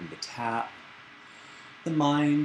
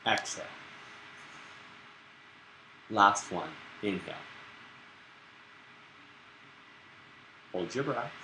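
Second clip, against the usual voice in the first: guided breathing; 2 semitones higher, 6 dB quieter than usual. Acted. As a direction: lead the class through each breath.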